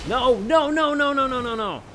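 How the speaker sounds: panicked high wail. Real, not acted. Caught by enemy.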